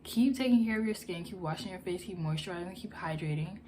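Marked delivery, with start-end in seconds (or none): none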